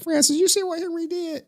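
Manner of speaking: high squeaky mock voice of people asking Francis; mock whiny caller. Streamer mocks people gossiping to Francis in a squeaky voice.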